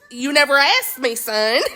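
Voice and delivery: sass him in a mild Southern voice; a hint of Southern accent, mock sassy. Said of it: "My Southern".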